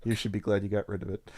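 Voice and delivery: normal talking voice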